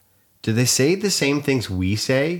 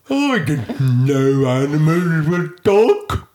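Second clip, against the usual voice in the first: slow, higher put-on hippo voice; comically drawn-out talking hippo; 5 semitones higher, 4 dB louder, much slower than usual. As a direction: talk like a big cartoon hippo. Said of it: "Yeah, as a hippo".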